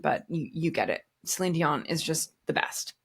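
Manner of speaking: ordinary delivery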